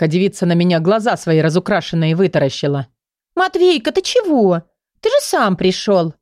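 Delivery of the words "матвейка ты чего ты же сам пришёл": put-on cooing girl. A narrator's reading. Girl coos at the hero.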